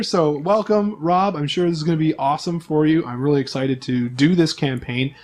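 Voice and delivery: ordinary talk, nothing marked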